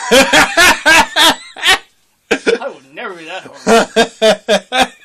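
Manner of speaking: laughing through the words. Real, not acted. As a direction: protest while cracking up. Laughing protest.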